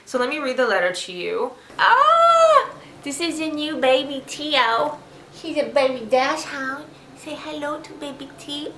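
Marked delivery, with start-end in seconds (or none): 1.8-2.7 high squeal of delight at the puppy. Real, not acted. Owner spots her new puppy, delighted high squeal. 3.0-8.7 puppy talk. Real, not acted. Owner introducing her baby dachshund, high baby talk.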